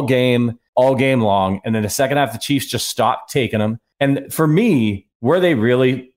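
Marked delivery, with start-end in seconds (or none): none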